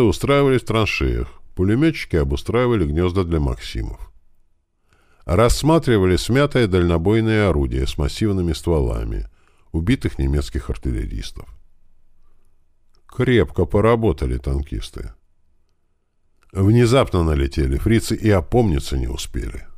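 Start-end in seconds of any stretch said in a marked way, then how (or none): none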